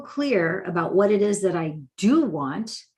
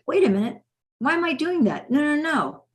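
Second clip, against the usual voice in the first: higher-pitched, acted self-questioning; alarmed realization; 7 semitones higher than usual. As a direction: catch yourself and object, voice lifting. Acted self-talk catching oneself.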